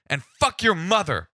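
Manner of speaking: high, cursing outburst; agitated caller. Retold or imitated. Caller's parting insult to the cop, high and agitated. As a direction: spit the insult out as the irate caller. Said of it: "he's, like, really agitated".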